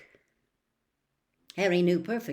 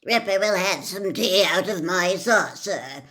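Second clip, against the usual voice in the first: louder, hearty bossy aunt; Aunt Marge; 7 dB louder than usual. Acted. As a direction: declare it grandly like a big loud guest.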